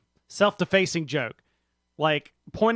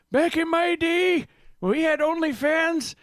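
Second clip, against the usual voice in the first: put-on old man voice; 12 semitones higher, 3 dB louder than usual. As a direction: play a grumpy grandpa reminiscing. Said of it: "She loves my old man voice".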